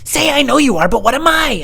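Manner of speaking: loud high-pitched imitation of mom; mom insisting. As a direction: push the line harder, squeaky and insistent. Mom insists in acted argument.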